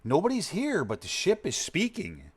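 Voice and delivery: high-pitched, hammed-up movie quote; puzzled Klingons. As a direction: act out a crewman reporting upward. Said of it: "they're like".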